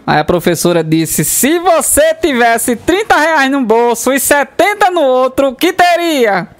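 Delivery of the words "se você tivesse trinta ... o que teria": slow, higher teacher voice. Acted. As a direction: ask it slowly like a teacher. Teacher asks slowly.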